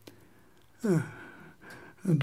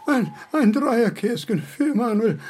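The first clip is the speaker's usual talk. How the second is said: high, stammering giggle through the words; confused laughing; 6 semitones higher than usual. Acted. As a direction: laugh helplessly while stuttering. Confused old Kant after nightmare.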